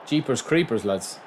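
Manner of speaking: usual speaking voice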